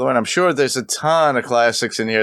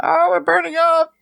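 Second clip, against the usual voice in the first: very high mock squeal; squeaky high voice. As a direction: squeal like a burning skeleton. Mock skeletons burning.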